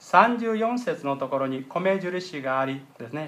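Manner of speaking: ordinary talk, nothing marked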